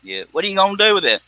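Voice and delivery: ordinary talk, nothing marked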